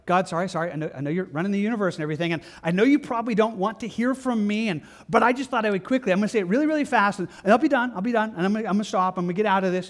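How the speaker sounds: mock anxious, rushed prayer; rushed timid prayer. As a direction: hurry through it as if bothering God.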